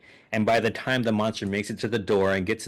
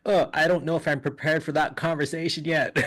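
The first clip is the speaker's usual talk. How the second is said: nervous parent; 7 semitones higher than usual. Retold or imitated. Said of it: "which I'm like".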